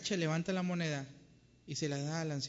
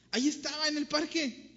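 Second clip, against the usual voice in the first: put-on high, loud, breathless little boy's wonder; excited child; 11 semitones higher, 5 dB louder than usual. Boy tells his mother about the smile he saw, high excited child voice. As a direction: gush like a thrilled seven-year-old.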